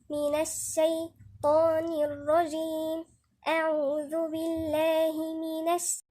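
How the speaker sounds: slow, measured tajweed recitation; unhurried, even-toned chanting; Quran recitation. Shown as a demonstration. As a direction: recite it with long drawn-out vowels.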